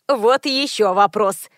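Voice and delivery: usual speaking voice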